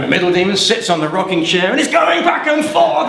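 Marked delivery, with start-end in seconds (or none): none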